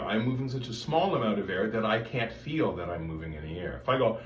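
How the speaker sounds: ordinary delivery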